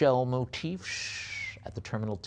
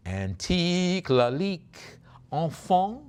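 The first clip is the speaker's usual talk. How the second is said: slow, high, stretched French flourish; showy French accent; 6 semitones higher, 5 dB louder, slower than usual. Pretend. Auctioneer presenting a Lalique box, showy French accent. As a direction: relish the French name, drawing it out.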